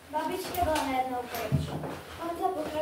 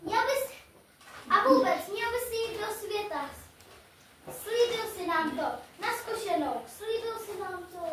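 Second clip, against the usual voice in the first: stern father; 7 semitones higher, 5 dB louder than usual. Acted. Father voice, raised pitch.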